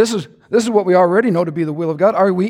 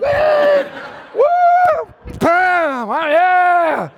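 celebration shout; 11 semitones higher, much slower than usual. Retold or imitated. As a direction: whoop like a touchdown celebration. Celebrating after the hug.

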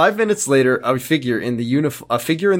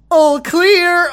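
high, loud put-on voice; Josh voice; 5 dB louder than usual. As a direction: pitch it way up and call it out. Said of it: "why did I do Josh voice?".

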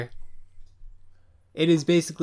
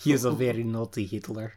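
put-on Russian accent; 3 semitones lower, 3 dB quieter than usual. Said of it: "You sounded Russian there".

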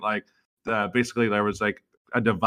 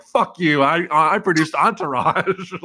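loud, higher mock defiance; Mark Wahlberg; 6 semitones higher, 5 dB louder, slower than usual. Actor refusing to apologize to his victim, mock indignation. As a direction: snap back with puffed-up indignation. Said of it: "he's like".

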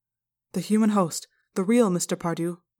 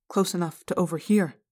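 plain reading voice

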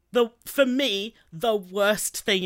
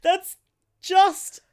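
incredulous; 10 semitones higher, 6 dB louder, slower than usual. Real, not acted. Disbelief after gift card.

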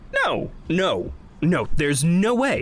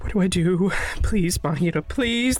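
halting, desperate calls to a still friend; frightened pleading; 3 dB quieter than usual. Acted. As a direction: beg her to answer, breaking off. Friend unresponsive after shock.

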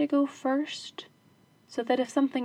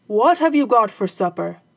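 husband's voice; 10 dB louder than usual. Acted. Husband asks wife about supper.

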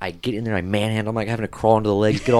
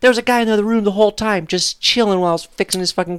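much higher and louder, fuming; indignant rant; 11 semitones higher, 4 dB louder than usual. Real, not acted. Telling friends about the lazy husband, fuming and loud. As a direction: vent the outrage full throttle.

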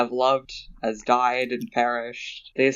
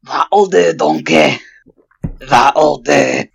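loud, booming Shrek voice; Shrek impression; 3 semitones higher, 10 dB louder, much slower than usual. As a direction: belt it out as Shrek addressing Donkey. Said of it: "was a good Shrek impression".

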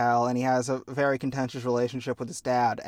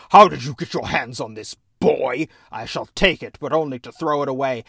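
stern father Hades; 5 dB louder than usual. Retold or imitated. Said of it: "It's like".

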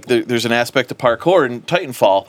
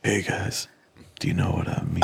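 low, soft sultry purr; femme fatale; 6 semitones lower, 8 dB quieter than usual. Retold or imitated. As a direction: breathe it low like a seductress. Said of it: "He says it in a sultry voice".

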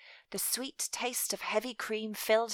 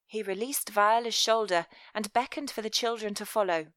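ordinary delivery, nothing marked

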